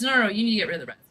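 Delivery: fast, loud, repeated no no no; adamant insistence. Retold or imitated. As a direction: imitate his pushy insistence. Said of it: "He was very adamant about that".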